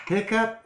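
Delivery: high hiccup sound; mock hiccup. Story hiccup sound effect.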